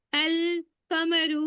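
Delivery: अल कमरु slow with a clear l; teacher models a moon letter; moon letter, lam said. Shown as a demonstration. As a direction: sound the l in अल कमरु slowly. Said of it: "मैं अल पढ़ रही हूँ".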